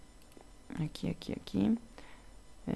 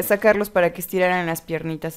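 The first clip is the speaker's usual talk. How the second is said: ordinary talk, nothing marked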